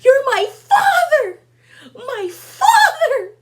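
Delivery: high, slow, laughing cry of my father; over-the-top laughing wail; mock anguished yell. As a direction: ham up a wounded son shouting at his dad. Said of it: "And he was like".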